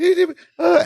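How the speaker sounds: very high Balki squeak; Balki quote. Retold or imitated. Balki finishing his excuse, squeaked high.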